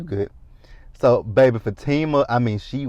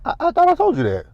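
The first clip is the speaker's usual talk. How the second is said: a mock guilty squeak, much higher; caught-out squeak; 4 dB louder, much faster than usual. Gary caught out on phone.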